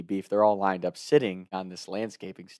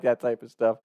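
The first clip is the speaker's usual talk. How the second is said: ordinary talk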